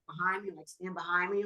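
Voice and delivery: hushed to daughter. Retold or imitated. Quietly telling daughter to hide.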